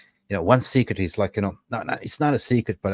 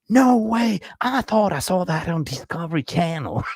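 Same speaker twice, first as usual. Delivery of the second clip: much higher, amazed and gullible; 9 semitones higher than usual. Retold or imitated. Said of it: "And he goes".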